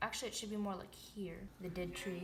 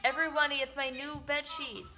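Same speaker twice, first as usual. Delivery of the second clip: announcing loudly; 4 semitones higher, 7 dB louder than usual. Pretend. Announcing bed sheets.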